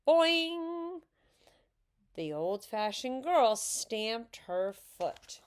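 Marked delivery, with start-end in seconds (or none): 0.1-1.0 high, loud twanging cry; high, ringing sound-effect shout; playful yell. A narrator's reading. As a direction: yell it gleefully like a bouncing spring. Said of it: "He too tweaked a curl and yelled".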